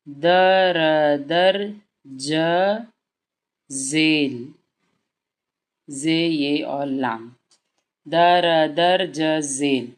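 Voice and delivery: letter by letter, slowly joined; drawn-out teacher spelling. Shown as a demonstration. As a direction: sound out each letter for a child. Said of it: "धीमे धीमे जुड़वाइए".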